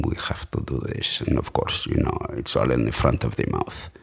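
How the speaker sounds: soft, low Spanish-accented English. Shown as a demonstration. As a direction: quietly demonstrate a Spanish accent, sounds forward in the mouth. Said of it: "with this audiobook in Spanish, it's like".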